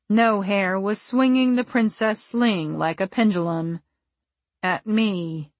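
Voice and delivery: usual speaking voice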